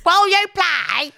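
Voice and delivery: put-on high drunken begging; drunk friend impression. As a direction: whine it like a tipsy mate. Mimicking drunk friend at party.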